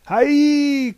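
high, loud gleeful cry; excited cry. Acted. Prometheus jumping into the pool, an excited cry. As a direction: whoop it while leaping in. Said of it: "cried Prometheus".

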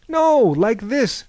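high, loud and slow showing-off call. Acted. Thales to his friends at the railing, showing off. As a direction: show them how it's done.

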